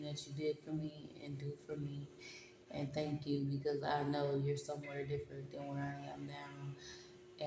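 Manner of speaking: soft, quiet and tender, speaking to her future self; a loving near-whisper to yourself; tender hushed message. Real, not acted.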